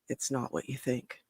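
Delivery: low, hushed retold aside; retold confiding. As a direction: confide it quietly, as if tucked in a corner.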